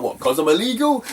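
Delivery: high, giggly mock question; laughing comeback. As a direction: tease back through laughter. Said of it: "I could not stop laughing".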